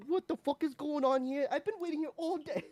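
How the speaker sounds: high, exasperated New York rant; angry New Yorker. Retold or imitated. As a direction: complain like a fed-up New Yorker. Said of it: "one New Yorker being like".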